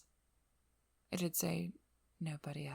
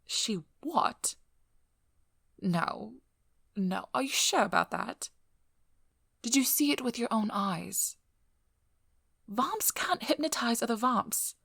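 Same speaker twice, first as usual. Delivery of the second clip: higher, louder incredulous questioning; startled and urgent, pitched up; shocked disbelief; 5 semitones higher, 6 dB louder than usual. Acted. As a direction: react to alarming news, refusing to believe it. Shocked at alarming news about Dorothy.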